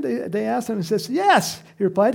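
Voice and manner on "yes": high, loud emphatic yes; emphatic reply. Acted. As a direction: answer with a punchy burst. Voices Jesus's reply.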